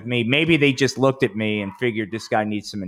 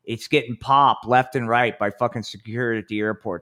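normal talking voice

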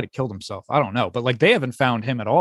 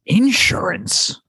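incredulous; 8 semitones higher, much slower than usual. Real, not acted. Incredulous echo of insurance claim.

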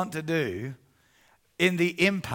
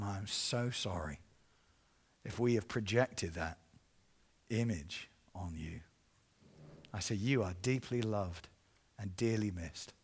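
tender apology; 9 semitones lower, 9 dB quieter than usual. Retold or imitated.

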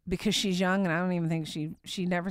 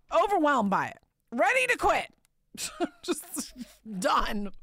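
overwhelmed, pitch climbing higher; rising distress; 9 semitones higher, 3 dB louder than usual. Retold or imitated. Preacher recalling a breaking point, voice climbing higher and louder. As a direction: let it spiral up, higher and louder. Emotional peak of story.

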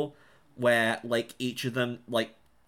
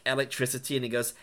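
ordinary delivery

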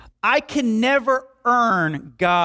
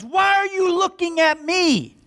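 mock boastful shout; 10 semitones higher than usual. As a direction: shout it like a show-off soaking up praise. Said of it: "he opens up his mouth and goes".

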